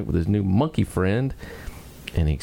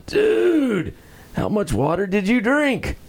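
stunned stoner monkey; 12 semitones higher, slower than usual. Acted. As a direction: gape at the huge lizard. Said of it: "Monkey looks down and says".

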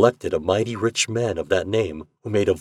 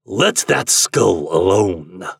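slow, gruff and louder; 5 dB louder, slower than usual. Acted. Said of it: "said a gruff voice".

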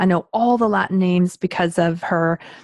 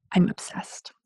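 whispered confession; 7 dB quieter, slower than usual. Real, not acted. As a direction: breathe it like a secret.

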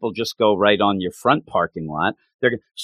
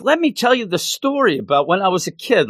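higher, eager long-winded chatter; rambling storyteller; 6 semitones higher than usual. Retold or imitated. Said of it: "Or he's like".

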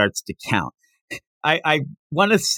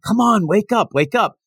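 higher, urgent pleading call; mild mock scream; 9 semitones higher than usual. Friends screaming at sleeping Goku, mildly mock-urgent. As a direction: imitate friends urging someone awake. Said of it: "they're all screaming".

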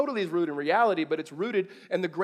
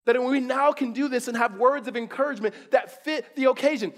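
normal talking voice

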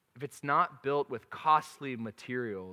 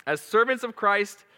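giggling, higher and louder; laughing through the verse; 8 semitones higher, 9 dB louder than usual. Real, not acted. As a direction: read it while cracking up. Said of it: "I got baby voice going".

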